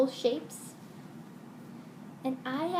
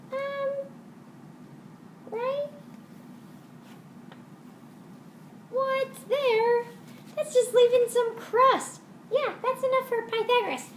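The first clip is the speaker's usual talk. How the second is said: cheeky little puppet grabbing more; high puppet voice; 11 semitones higher than usual. Acted. Second puppet voice.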